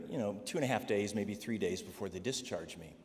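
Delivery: usual speaking voice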